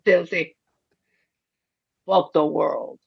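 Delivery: mumbling Stallone impression, mouth full of marbles; slurred Stallone drawl. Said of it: "put, like, marbles in my mouth".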